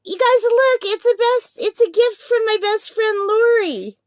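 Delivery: high, loud burst of discovery; delighted surprise. Real, not acted. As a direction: announce a wonderful surprise to your viewers. Discovers who sent the surprise gift.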